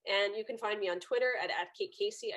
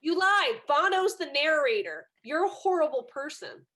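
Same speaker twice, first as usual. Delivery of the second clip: higher and louder, exasperated protest; indignant outburst; 6 semitones higher, 7 dB louder than usual. Retold or imitated. Daughter scolding her father as a horrible liar, loud and indignant. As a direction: keep hammering the accusation, voice up high. Continues rebuking father's lie.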